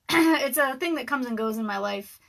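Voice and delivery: usual speaking voice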